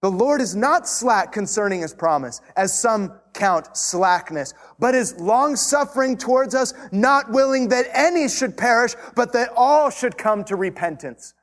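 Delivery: a touch of pulpit fervour on the verse; scripture read aloud with rising force; preached scripture reading. Acted.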